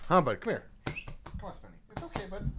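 coaxing the dog. Real, not acted. Owner coaxes his dog to come over, soft and high. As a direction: gently call the dog over.

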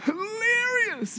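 mock-delighted drawl on hilarious now; drawn-out amusement. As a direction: stretch hilarious out slowly in a high voice. Teasing the funny part of Esther.

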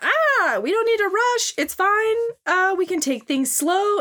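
high mock girl voice, flustered; mock anime girl. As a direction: play a flustered cartoon heroine. Voicing the girl in the hentai.

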